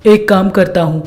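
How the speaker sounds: husband talking to his wife; husband, considerate. Acted. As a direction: propose a solution in a low pitch. Voices the husband.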